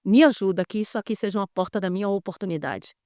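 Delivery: a soft, hushed prayer. Retold or imitated. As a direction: pray quietly, looking up to heaven. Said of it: "olhei pro céu e falei".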